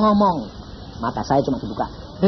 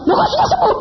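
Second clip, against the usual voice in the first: high, amazed question; astonished. Retold or imitated. Friend finds the sick child healed, high amazed question. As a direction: ask it stunned, pitch jumping up.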